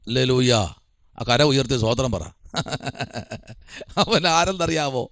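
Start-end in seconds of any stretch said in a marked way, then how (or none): 2.5-3.4 short low chuckle; soft laugh to himself. Real, not acted. Preacher amused after asking for praise, soft chuckle.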